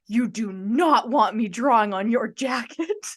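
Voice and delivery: slow, emphatic warning with NOT punched; dead serious. Retold or imitated. Singer warns fan about his drawing, dead serious. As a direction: imitate a gravely serious warning, word by word. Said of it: "looks at me dead serious in the eyes".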